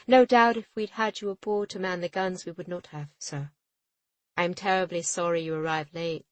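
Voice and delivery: a captain mocking the late official; sarcastic retort. A narrator's reading. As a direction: sweeten the insult.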